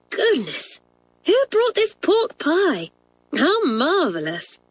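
high, slow gasp of surprise; light up at the discovery; delighted surprise. A narrator's reading. Janet finding the pork pie, high and surprised.

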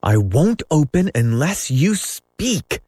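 a lightly voiced defiant boy; Peter crying out. A narrator's reading. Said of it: "Peter cried".

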